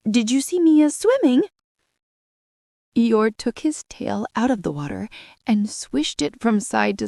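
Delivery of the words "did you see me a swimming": squeaky Roo. A narrator's reading. Roo boasting again.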